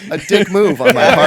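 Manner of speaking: high, laughing through the words; words breaking up with laughter; real laughing. Real, not acted. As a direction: talk through helpless laughter. Cracking up after failed joke setup.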